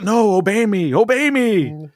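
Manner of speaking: high, whiny plea to an unruly dragon; mock panicked pleading. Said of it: "everybody's like".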